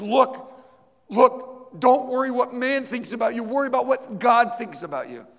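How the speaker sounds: high, loud urgent plea; pleading. Retold or imitated. The preacher begs her to believe, high and loud. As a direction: beg her with everything. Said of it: "I began to plead with her".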